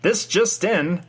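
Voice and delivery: put-on old-timey newsy voice. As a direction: announce it like a vintage newscaster, drawn out. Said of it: "old-timey newsy voices".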